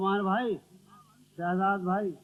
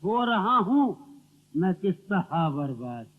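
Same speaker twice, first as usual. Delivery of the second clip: ghazal recitation; 3 semitones lower, 5 dB louder, slower than usual. Acted. Poet reciting to the gathering, declamatory verse recital. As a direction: declaim verse with weighted pauses.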